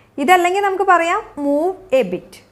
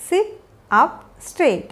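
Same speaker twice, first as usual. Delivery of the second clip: slow, three separated words; 2 semitones higher, much slower than usual. Shown as a demonstration. Teacher modelling a posture instruction for learners, word by word. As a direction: pause after "sit" and after "up".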